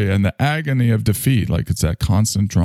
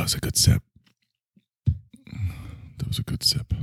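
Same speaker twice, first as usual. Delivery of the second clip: breathy whisper; 8 dB quieter than usual. Real, not acted. Host savoring his sip in a whisper. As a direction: whisper it to yourself.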